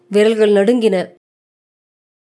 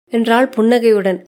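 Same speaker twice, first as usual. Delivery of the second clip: plain reading voice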